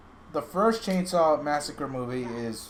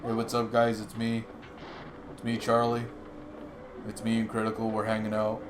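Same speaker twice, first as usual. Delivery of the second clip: streamer voices; 3 semitones lower, 4 dB quieter than usual. Retold or imitated. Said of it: "They both sound the same".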